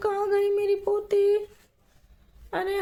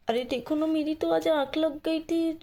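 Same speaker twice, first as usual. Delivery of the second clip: ordinary talk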